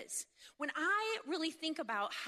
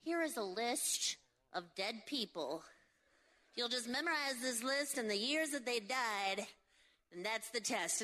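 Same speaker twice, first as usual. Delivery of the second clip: bored teacher; 2 semitones lower than usual. Retold or imitated. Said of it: "teachers were pretty much like".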